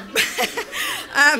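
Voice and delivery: loud high embarrassed laugh; high burst of laughter. Real, not acted. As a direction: let out a sudden high laugh. Laughs at own rushing.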